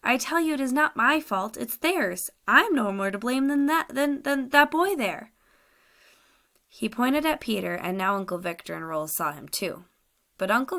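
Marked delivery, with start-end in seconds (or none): 0.0-5.3 high and louder, slowing to the end; frightened prisoner begging not to be blamed; desperate protest. Acted. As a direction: plead your innocence, pushed up high. Captive protests his innocence.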